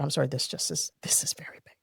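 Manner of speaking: hushed whisper, barely voiced; retold whisper. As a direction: whisper it like it's too big to say aloud. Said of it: "but he just said".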